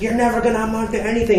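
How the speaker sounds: fast, high dad outburst; imitating dad mid-argument; screaming father. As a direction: fling it at your son as angry dad. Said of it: "Screaming in my face".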